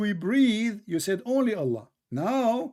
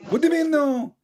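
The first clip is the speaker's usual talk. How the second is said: higher, louder challenge; indignant disbelief; 8 semitones higher, 5 dB louder than usual. Real, not acted. Debater challenging an opponent's flat denial, indignant disbelief. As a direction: throw the question back, loud and high. Rejected answer in heated debate.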